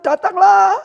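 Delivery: loud, high, drawn-out summons; glad call. Acted. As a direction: call out joyfully to come. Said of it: "Yesus senang". (seruan senang dan lantang)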